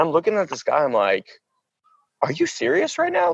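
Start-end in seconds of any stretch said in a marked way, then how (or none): 2.2-3.3 incredulous challenge. Retold or imitated. Food carrier to man blocking the line, high incredulous challenge. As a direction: replay your disbelief, pitched way up.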